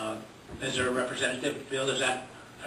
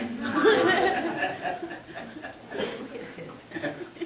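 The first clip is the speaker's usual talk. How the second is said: laughing. Real, not acted. Joking about turning forty.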